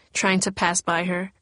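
usual speaking voice

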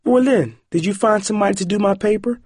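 put-on high, eager girl's voice; excited. A narrator's reading. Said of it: "Corey spoke excitedly when she heard his voice".

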